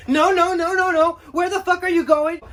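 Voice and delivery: high, loud no-no-no; shouting homeowner. Acted. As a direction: yell him back to the door. Homeowner shouts him back.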